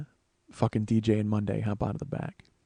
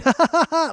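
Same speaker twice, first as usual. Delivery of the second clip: high, loud maniacal cackle; imitated psychotic laugh; 7 dB louder than usual. Woman laughs at the arrested men, psychotic cackling. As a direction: cackle like you're having fun, unhinged. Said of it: "really genuinely psychotically laughing".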